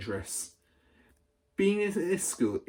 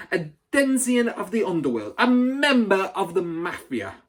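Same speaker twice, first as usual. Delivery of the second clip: booming tirade; 10 semitones higher, 8 dB louder than usual. A narrator's reading. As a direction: spit each insult at the boy. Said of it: "boomed the headmistress".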